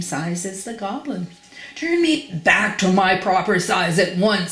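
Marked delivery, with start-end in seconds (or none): 1.8-4.5 indignant, acted angry demand; sputtering rage. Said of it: "sputtered Mister Uppity in a rage".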